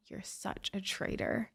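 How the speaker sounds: retold angry whisper; hissed whisper. Mom whispering at her daughter outside court. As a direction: whisper the betrayal.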